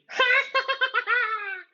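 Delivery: very high gleeful ghostly cackle; shrieking mocking cackle; poltergeist cackle. A narrator's reading. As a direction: laugh like a spiteful little spirit. Peeves gloating.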